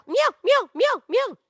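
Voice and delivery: high, loud, quick meows; short high-pitched cat cries. Shown as a demonstration. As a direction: mew like a cat in even bursts. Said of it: "bark, meow".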